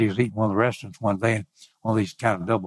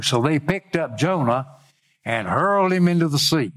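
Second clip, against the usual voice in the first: declaimed Bible reading, lifted and deliberate; scripture reading; 5 semitones higher than usual. Acted. Preacher reads the sailors questioning Jonah, declaimed. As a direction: proclaim each verse phrase by phrase with pauses.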